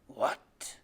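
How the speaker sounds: whispered, stunned question; menacing whisper. Acted. Father lets go and turns on the boy, menacing whisper. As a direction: breathe it out as a dangerous whisper. Killer father startled by accusation.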